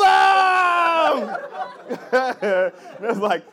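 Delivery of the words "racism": shouted punchline. Real, not acted.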